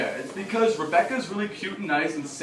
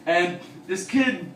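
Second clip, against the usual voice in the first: usual speaking voice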